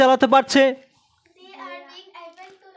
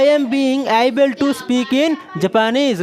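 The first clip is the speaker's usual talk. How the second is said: slow dictation; 3 semitones higher, much slower than usual. Shown as a demonstration. Teacher dictating the answer slowly.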